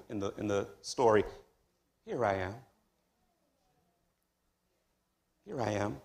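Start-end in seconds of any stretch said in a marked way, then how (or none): 2.1-6.0 soft, hushed and tender reply. Acted. Abraham answering God tenderly, softly and intimately. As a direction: answer gently, like to a friend. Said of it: "listen to the tender way that he says".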